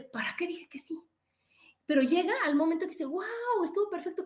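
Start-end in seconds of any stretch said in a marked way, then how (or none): 0.0-1.0 unvoiced whisper of regret. Jim Carrey's character regretting his yes, whispered. 3.0-4.2 delighted wow. Acted. Character delighted afterwards.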